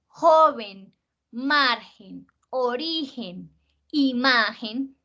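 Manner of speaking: "joven", "margen", "origen", "imagen" con JO, MAR, RI, MA marcadas; fuerza en la penúltima. Shown as a demonstration. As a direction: alarga la sílaba fuerte de "origen" e "imagen", despacio. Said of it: "que como hacemos fuerza". (lista pausada, JO-ven, MAR-gen, o-RI-gen, i-MA-gen)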